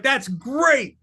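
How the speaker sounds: high, bright mock delight at the gore; delighted exclaim. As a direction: gush it like a thrilled fan. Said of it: "I was like".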